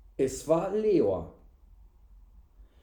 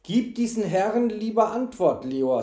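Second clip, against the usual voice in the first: mildly stern raised-voice order; 6 semitones higher, 4 dB louder than usual. A narrator's reading. Innkeeper reprimanding his servant, stern. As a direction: scold him into answering. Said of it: "sagte der Wirt streng".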